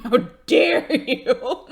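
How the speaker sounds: mock outrage.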